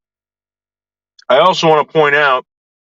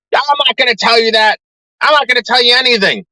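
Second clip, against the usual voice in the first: higher, put-on voice refusing to answer; sneering impression of the stubborn lady; mocking mother's voice; 9 semitones higher than usual. Mimicking the mother refusing to name her daughter. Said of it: "Listen, lady".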